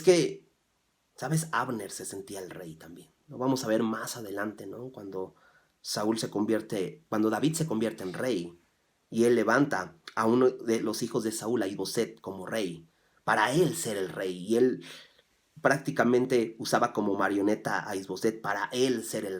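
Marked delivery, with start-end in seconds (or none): none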